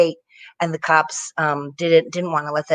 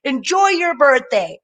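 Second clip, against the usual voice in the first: mock cop blessing; 9 semitones higher, 4 dB louder than usual. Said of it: "And they said".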